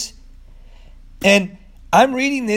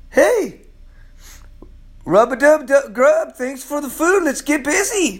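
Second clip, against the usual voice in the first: higher, cheerful call; playful Peter; 8 semitones higher than usual. Acted. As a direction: sing out like a jolly Peter. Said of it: "Peter is saying".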